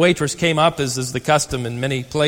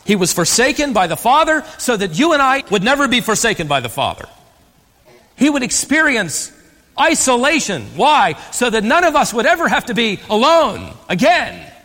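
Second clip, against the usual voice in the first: fervent preaching; 7 semitones higher, 4 dB louder than usual. Acted. Preacher raising voice at climax.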